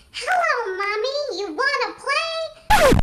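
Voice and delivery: put-on very high, singsong baby-doll voice; creepy talking doll. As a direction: stretch the last words out, high and eerie. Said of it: "there is this doll ... She goes".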